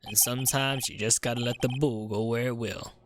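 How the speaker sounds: easygoing Texan cowboy voice; southern cowboy accent. Acted. Cowboy detective reminiscing about bulls, light Texan accent. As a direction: spin it like a folksy Southern lawman. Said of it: "I've never met someone who talks like that".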